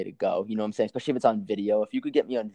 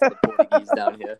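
a mock-grand, high, loud declaration; grand proclamation; 8 dB louder than usual. Boasting to his co-host in a raised, mock-epic voice. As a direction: announce yourself as the last of your people. Proud joke about himself.

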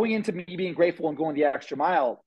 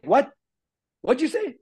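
mock mentor disbelief; 9 semitones higher than usual. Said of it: "he's like".

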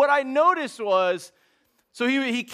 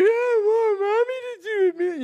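put-on crying child; 12 semitones higher than usual. Scared boy crying that he wants mommy, high tearful wail. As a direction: sob it out like a kid close to tears. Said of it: "He's like".

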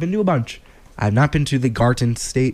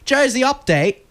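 high-pitched British segment title; British accent; 10 semitones higher than usual. Shown as a demonstration. Host introducing his Jersey segment, mock British accent. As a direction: announce it in a put-on British accent. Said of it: "British accent, perfect".

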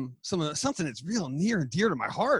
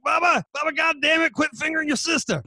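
put-on Southern accent; 9 semitones higher, 3 dB louder than usual. Parent calling Bubba in for dinner, mock Southern scolding. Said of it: "people in the South".

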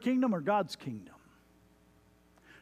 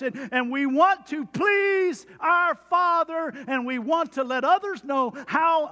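high, loud and slow; preaching shout; 8 semitones higher, 4 dB louder than usual. Real, not acted. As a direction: preach it out with full force. Sermon climax.

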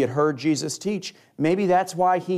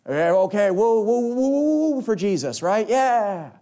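mock hype; 6 semitones higher than usual. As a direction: ham up an empty hype crowd. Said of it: "stir up people's emotions".

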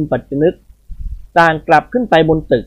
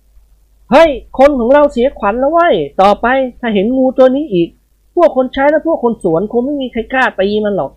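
Nikorn's voice; 8 semitones higher than usual. Acted. Nikorn speaks to his friends.

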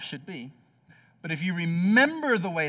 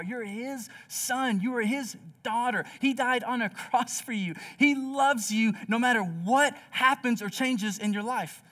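rising fervor; 6 semitones higher than usual. Real, not acted. Pastor's climax of assurance.

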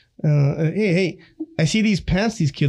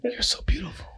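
tender whisper; 8 semitones higher, 8 dB quieter than usual. Real, not acted. Compliment to wife.

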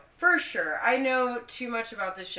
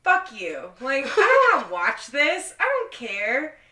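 louder, higher bitter ranting; 7 semitones higher, 9 dB louder than usual. Real, not acted. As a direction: vent your bitterness loudly. Bitter rant about engagements.

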